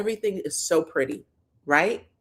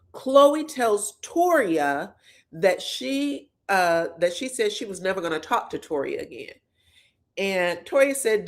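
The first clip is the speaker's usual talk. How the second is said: usual speaking voice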